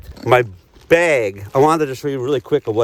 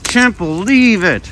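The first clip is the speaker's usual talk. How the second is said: hammed-up frustrated roar, higher and louder; mock aggression; 10 semitones higher, 4 dB louder, slower than usual. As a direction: let out fake rage at life. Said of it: "this is aggression where you just go".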